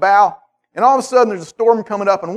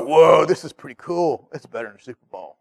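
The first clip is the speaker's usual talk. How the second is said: awed bro voice; 4 semitones lower than usual. Retold or imitated. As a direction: gush like a sports fan. Disciples awed at transfiguration.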